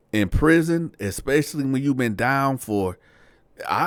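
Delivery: usual speaking voice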